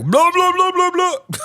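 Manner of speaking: loud, high mock babble; booming echo imitation. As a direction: blare out nonsense like whispers echoing in a room. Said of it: "and it sounds like".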